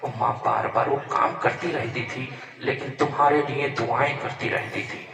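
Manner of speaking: loud, impassioned sermon delivery; preaching at full force. Acted. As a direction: preach it loud and urgent to the crowd.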